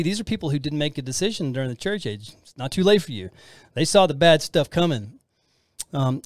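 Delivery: ordinary talk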